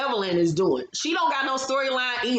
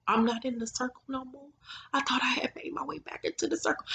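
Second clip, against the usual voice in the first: hushed, breathy, on the verge of tears; mock teary whisper; 3 semitones higher, 7 dB quieter than usual. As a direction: whisper it like she's about to cry. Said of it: "she looked like she wanted to cry".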